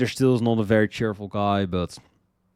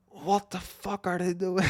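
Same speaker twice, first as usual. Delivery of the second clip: much higher, stunned; amazed disbelief; 10 semitones higher than usual. Retold or imitated. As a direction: gasp it out in awe. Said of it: "I'm like".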